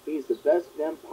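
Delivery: ordinary talk, nothing marked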